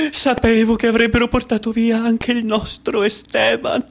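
high, tearful lament, dragged out slowly; desperate crying mother. Acted. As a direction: wail like a mother in a radio melodrama. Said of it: "E ora un tipico radiogram-, radiodramma argentino". (madre disperata che piange, voce alta e lenta)

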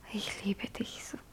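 slow, barely voiced declaration; whispered love. Edwarda whispers her love to Glan, slow and hushed. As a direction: breathe it out slowly, close.

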